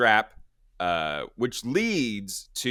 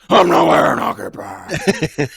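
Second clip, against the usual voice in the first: loud, higher, slow gravel-growl hero; Batman voice; 7 semitones higher, 13 dB louder, slower than usual. Shown as a demonstration. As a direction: bark it like Batman. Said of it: "fine line between Saw voice and Batman voice".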